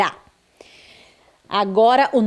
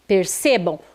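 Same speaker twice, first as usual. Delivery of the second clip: ordinary talk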